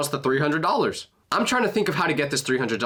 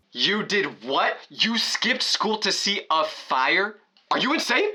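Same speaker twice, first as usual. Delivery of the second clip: imitating mom's shocked yell, pitched higher, slow; 6 semitones higher, slower than usual. Mom on the phone hearing her son skipped school, higher mock yell. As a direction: play the furious mom who can't believe it. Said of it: "And then she starts yelling".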